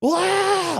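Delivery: high, loud mock baby cry; hammed-up baby scream; imitated baby wail. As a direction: wail like a startled toddler. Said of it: "Like, screaming, crying".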